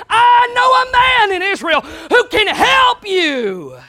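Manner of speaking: slow, loud, high proclamation; proclaiming. Acted. Little maid telling her mistress of the prophet, slow loud cry. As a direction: announce it like good news.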